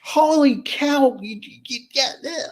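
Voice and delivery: playing his stunned wife; wife's astonished voice. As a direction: voice the wife gasping at the news. Wife voiced reacting in shock.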